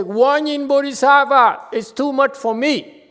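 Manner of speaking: high, loud desperate cry for help; loud, high panicked wail to Guan Yin; screaming plea. Shown as a demonstration. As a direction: scream it out like someone at the end of their rope. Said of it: "instead of screaming".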